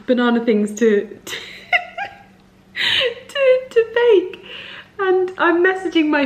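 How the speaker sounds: crying with laughter. Real, not acted. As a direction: squeeze the words out through laughter tears. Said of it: "I'm actually crying".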